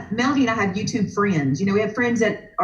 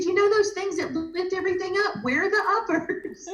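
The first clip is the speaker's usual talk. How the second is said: high-pitched retold plea, breaking into laughter; imitating searching guest; 10 semitones higher, 3 dB quieter than usual. Card-party guest explaining what she wants, imitated searching voice. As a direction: imitate her bewildered hunt, amused.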